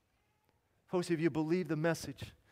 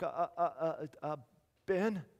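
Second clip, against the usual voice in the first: stammering; 5 dB quieter than usual. Retold or imitated. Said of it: "I could hardly talk".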